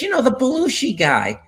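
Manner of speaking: put-on high Boston mom accent. Mother explaining which cousin to son, Boston accent. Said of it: "she says in that Boston accent".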